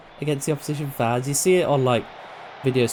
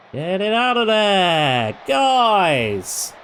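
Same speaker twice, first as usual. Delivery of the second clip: loud exasperated yell at the players; shouting at the screen; 7 semitones higher, 5 dB louder, much slower than usual. Real, not acted. Gamer yelling at his football team, loud and fed up. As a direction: bellow at your useless team.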